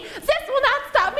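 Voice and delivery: very high villain shriek; acted frantic, squealing protest; frantic defiant villain. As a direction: push it way up, close to a scream. Villain repeats it, escalating to scream.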